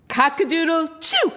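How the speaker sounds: high, loud crow ending in sneeze; sneezing rooster. A narrator's reading. Narrator as the rooster, loud crowing sneeze. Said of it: "Rooster crowed".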